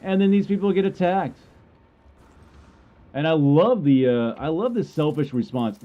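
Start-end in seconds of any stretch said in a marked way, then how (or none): none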